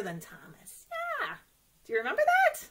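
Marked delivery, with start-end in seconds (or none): 1.8-2.7 high, bright, louder eager question; excited. Acted. Teacher excitedly asking the children about Gordon, high and eager. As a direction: ask it like you can hardly wait.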